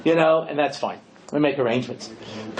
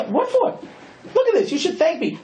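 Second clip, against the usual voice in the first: indignant; 12 semitones higher than usual. Retold or imitated. Indignant matchmade groom replies.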